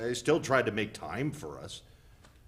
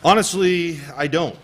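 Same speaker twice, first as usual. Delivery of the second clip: loud, high and sharp refusal; angry outburst; 9 semitones higher, 14 dB louder than usual. Acted. Husband flares up loudly at his wife about talking it over. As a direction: slam the door on the conversation. Arguing spouse refuses to discuss.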